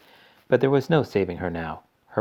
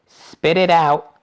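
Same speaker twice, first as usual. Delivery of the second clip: loud, drawn-out, higher command; urgent order; 8 semitones higher, 10 dB louder, much slower than usual. A narrator's reading. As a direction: insist slowly and loudly. Wonka orders gum spat out.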